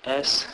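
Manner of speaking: S as a long loud "sss"; letter sound demo. Shown as a demonstration. Teacher shows how S is pronounced, a long hiss. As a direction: sustain S alone as a hiss, no vowel. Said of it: "S is pronounced as".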